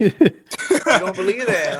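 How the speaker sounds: laughing disbelief. Real, not acted. Teasing a friend's pick.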